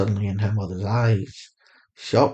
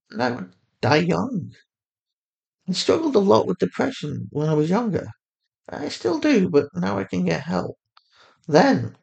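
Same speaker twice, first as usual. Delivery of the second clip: higher mother voice, calm and steady; Fatima, soothing mother; 8 semitones higher than usual. A narrator's reading. A mother on the phone calmly reassuring her lost daughter.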